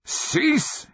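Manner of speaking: high-pitched raging command. A narrator's reading. Wizard ignored by the chained shadows, raging. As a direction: rage it out, voice leaping up. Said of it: "he raged".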